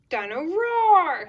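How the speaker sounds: high, loud, stretched-out roar; gleeful child roaring the toy's name; excited little George. Acted. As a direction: draw out ROAR like a playful toddler. George playing with his roaring toy.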